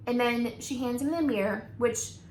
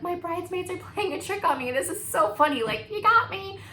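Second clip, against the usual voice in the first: high, giggly disbelief, thinking it's a prank; nervous laughing; 8 semitones higher, 4 dB louder than usual. Retold or imitated. Bride thinks bridesmaids pranked her, giggly disbelief. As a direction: laugh it off as a joke from friends. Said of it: "So I started laughing".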